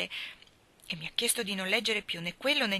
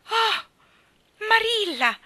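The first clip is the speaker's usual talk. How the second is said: overjoyed cry; 14 semitones higher, 9 dB louder, much slower than usual. Acted. Anna hears her dream offer.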